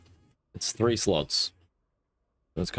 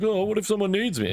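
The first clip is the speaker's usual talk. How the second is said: high whiny mum voice, far above his own; mocking panicked mum; 11 semitones higher than usual. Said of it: "It's like".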